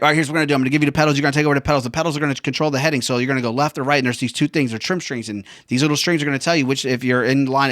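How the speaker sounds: fast, pushy mock explanation; rapid-fire instructor. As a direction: rattle off the instructions without a breather. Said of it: "he's going so fast that I couldn't understand".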